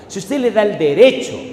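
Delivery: impassioned preaching push. Real, not acted. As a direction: lift the phrase over the room. Preacher repeats the claim louder.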